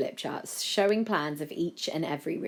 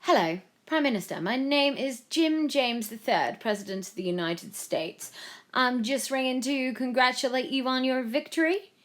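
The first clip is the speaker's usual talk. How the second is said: higher, stately American phone greeting; US President; 9 semitones higher, 3 dB louder than usual. A narrator's reading. The President rings to congratulate the Prime Minister, grandly.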